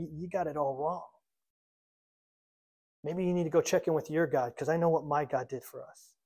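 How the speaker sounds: softly acted, understated confrontation; quiet challenge. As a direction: drop the volume and let the challenge land gently. Acted quiet challenge to enemy king.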